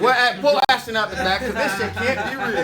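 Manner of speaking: disbelieving laughing outburst. Retold or imitated. Disbelief at being told of hit.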